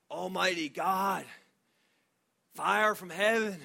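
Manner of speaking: put-on loud, slow, grand old-fashioned prayer voice; booming King James prayer. Pastor mocking student's fire-and-brimstone prayer, loud and slow. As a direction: boom it out like thunder from the pulpit. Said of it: "even his prayer was like New King James Version".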